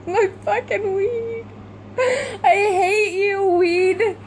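very high, drawn-out crying whine; half-laughing wail of loss; tearful whine. Real, not acted. Mourning her lost weed, high tearful whine.

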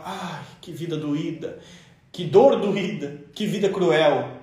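low, slow self-pitying moan; sorrowful lament.

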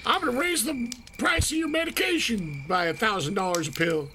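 a hint of a sneering price-gouger; smug pharma exec. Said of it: "That's who I'm picturing".

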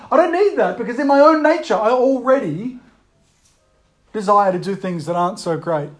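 ordinary talk

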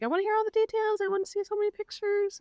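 imitated gushing friend, very high; retold bestie excitement; so excited. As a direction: demand it in a high, eager squeal. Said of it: "she was so excited".

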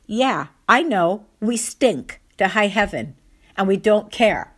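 emphatic, unapologetic comeback; defiant retort. Retold or imitated. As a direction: declare it proudly, drawing out each phrase. Aunt's acted retort to staring lady.

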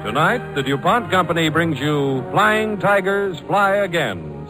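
ordinary talk, nothing marked

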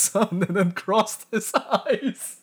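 high, slow words squeezed through laughter; cracking up. Real, not acted. Reader breaking at the farmer's son backstory, cracking up. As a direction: lose it laughing while reading.

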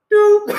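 high, loud doop of a keypad tone; imitated beep. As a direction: chirp it like a phone button. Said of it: "imitated phone beep".

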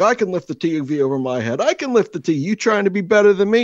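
high, heated mock argument; Seinfeldian bickering yell. Father and son bickering over lifting a TV, mock Seinfeldian yelling. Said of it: "yelling back and forth, honestly, almost Seinfeldian".

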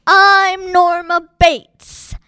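high, loud, slow mock mother voice; mock Norma Bates. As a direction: shriek it out as the killer in drag. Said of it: "he goes".

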